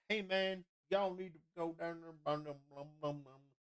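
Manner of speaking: high-pitched Southern salesman mumble; riverboat captain. Acted. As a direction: trail off into wordless Southern mumbling. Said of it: "is a fucking riverboat captain".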